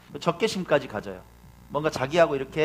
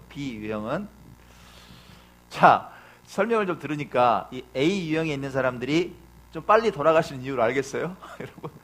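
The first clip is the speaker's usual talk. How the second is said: normal talking voice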